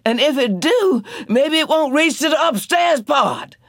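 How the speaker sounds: high, loud hopeful plea; Janey pleading. A narrator's reading. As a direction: argue to stay upstairs. Janey counseling.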